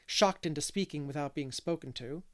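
ordinary talk

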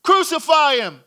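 loud, high mob cry; forceful high-pitched call; crowd shouting. Acted. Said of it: "you hear them shouting".

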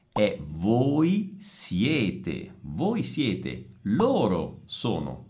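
"voi siete" drawn out very slowly, falling on "voi". Shown as a demonstration. Teacher spelling out the plural form, very slowly. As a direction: stretch "è voi siete" syllable by syllable. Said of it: "non è sono".

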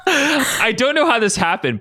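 slow words through real laughter; laughing. Real, not acted. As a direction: laugh while reading it. Narrator laughs while reading.

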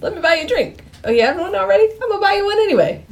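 high-pitched mock smooth talker; cartoonishly high come-on; Jacob's pickup line. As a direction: pitch it way up, insistently offering a drink. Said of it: "Jacob's thing is".

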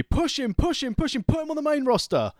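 high-pitched, insistent chant of urging; eager urging. Retold or imitated. Host mocking how WWE treats a wrestler, eager urging. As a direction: push each phrase up high, urgently. Mimics WWE's eager booking push.

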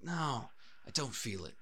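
lightly voiced stubborn actor, slow refusal; a hint of a moody film actor; Nicolas Cage. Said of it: "And he's like".

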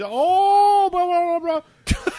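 high, loud mock-Spanish babble; loud neighbor imitation. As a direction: babble piercingly as the noisy neighbor. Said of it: "in loud Spanish".

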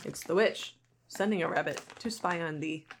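normal talking voice

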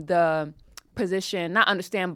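normal talking voice

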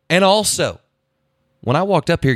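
a hint of official cop voice; police officer. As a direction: play an officer at the car window, pausing after "sir". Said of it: "And he's like".

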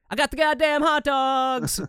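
high, slow mock-kid voice; dad replaying his son's line as a family joke; family catchphrase. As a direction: deliver it like the family's running joke. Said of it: "a song we sing".

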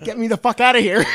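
loud, high mock yell breaking into laughter; frantic demand. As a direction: act out losing all composure, half laughing. Acted plea turns frantic, versus calm.

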